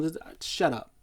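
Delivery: usual speaking voice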